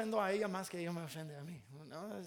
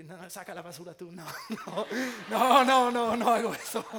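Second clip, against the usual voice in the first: laughing refusal; 6 dB louder than usual. Retold or imitated.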